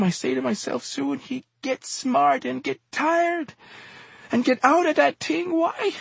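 very high, anguished pleading; sobbing. A narrator's reading. Exhorter standing over the fallen man, sobbing high-pitched plea. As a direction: wail it as a tearful reproach.

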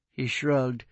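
usual speaking voice

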